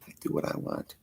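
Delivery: very soft, voiceless whisper; whispered like a sly aside; whispered defiance. Pretend. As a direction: drop to a hushed whisper. Same line repeated, hushed.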